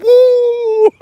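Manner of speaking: high, loud, held "woo"; imitated falsetto whoop. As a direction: mimic a pop singer's piercing whoop. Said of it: "he's like".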